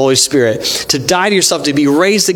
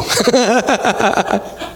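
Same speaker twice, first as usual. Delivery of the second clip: high-pitched, rippling laugh; real laugh; 7 semitones higher than usual. Real, not acted. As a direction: laugh at your own slip. Laughs at losing place in reading.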